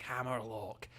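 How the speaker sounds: slow, soft Scottish Hammerlock; lightly Scottish Hammerlock; Scottish accent. Shown as a demonstration. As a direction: draw out Hammerlock like a Scot. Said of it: "say Hammerlock in a Scottish accent".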